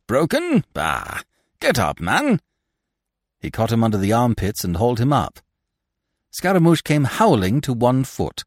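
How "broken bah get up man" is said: scoffing. A narrator's reading. Binet scoffs at injured actor.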